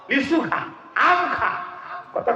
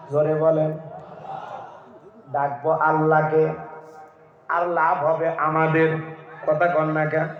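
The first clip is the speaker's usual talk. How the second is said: ordinary talk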